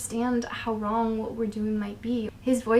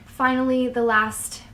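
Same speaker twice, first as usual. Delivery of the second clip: ordinary talk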